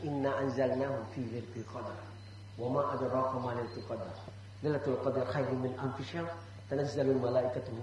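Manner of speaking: low, soft recitation of al-Qadr; Quran recitation. Shown as a demonstration. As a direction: recite it quietly and reverently. (membaca surat dengan lirih dan rendah)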